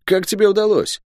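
high, incredulous soldier voice; excitable guardsman, marvelling; amazed guardsman. Acted. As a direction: ask it high, in astonished admiration. Guard Luk amazed at friend.

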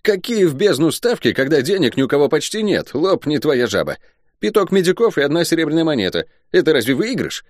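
high, outraged guardsman voice; comic, high-pitched affront; puffed-up indignation. Acted. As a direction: protest like an offended turkey-cock. Guard Luk keeps protesting.

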